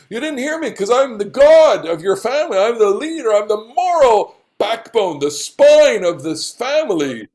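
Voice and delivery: high, swelling self-glorification; hammed-up, grandiose boasting; pompous. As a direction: puff yourself up as the family's moral leader. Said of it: "I'm saying, what pomposity".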